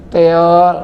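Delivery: tongue-tied "Teol" for telor; husband answering his wife, lisping. Retold or imitated. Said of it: "Langsung cadel".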